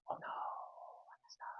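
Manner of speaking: put-on breathless whisper of a kid caught out; whispered panic. Son caught coming home late, panicked whisper. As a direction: hush it, like you just realised you are in trouble.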